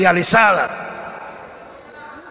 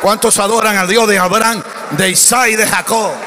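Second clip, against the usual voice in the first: preacher's rallying call, building. Real, not acted. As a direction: rouse the congregation step by step. Rallies congregation to worship, building.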